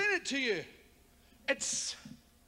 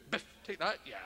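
much lower, softer growl; violent parent; 7 semitones lower, 6 dB quieter, faster than usual. Retold or imitated. Scottish parent hitting his child, low growl. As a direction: growl like a brutal Scottish dad.

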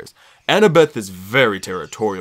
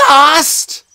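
high, loud incredulous yell of Lost; a furious friend yelling Lost; 11 dB louder than usual. A narrator's reading. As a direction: shout the word back in disbelief. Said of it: "she yelled".

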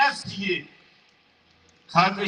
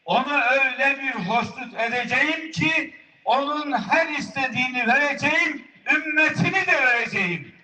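rising promise; 7 semitones higher than usual. Acted. God's promise, rising high.

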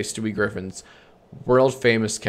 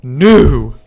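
loud shouted no; big cartoon scream; screamed catchphrase; 3 semitones higher, 13 dB louder than usual. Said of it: "His signature catchphrase".

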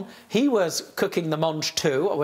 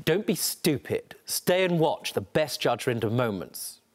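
stern promo read; 4 semitones lower than usual. Acted. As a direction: bark out the sign-off. Closing channel promo read.

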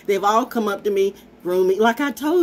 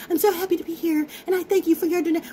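mock fake happiness; 8 semitones higher, faster than usual. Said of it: "pretending to be so happy".

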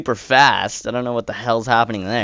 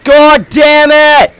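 panicked yelling; 13 dB louder than usual. Real, not acted. Crashing, can't reverse.

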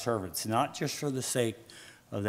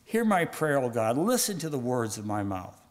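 emphatic plea, high bursts falling away; fervent prayer; 4 semitones higher than usual. Acted. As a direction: declaim David's prayer to God. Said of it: "David prayed".

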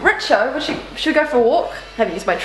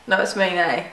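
usual speaking voice

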